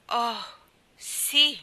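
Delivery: high, loud cry as if struck; anguished cry. A narrator's reading. The girl cries out to Herr Sörensen, stricken cry. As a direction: cry out as if hit in the heart. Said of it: "gridò la ragazza".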